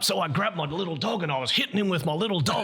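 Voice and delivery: high, animated retelling voice; interviewed crocodile attack survivor. Retold or imitated. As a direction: imitate an excited local telling his story. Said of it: "he was just like".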